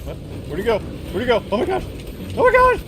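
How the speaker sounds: high, loud frantic cries; panicked yelling. Real, not acted. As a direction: shriek in fear and confusion. Losing the tiger, panic.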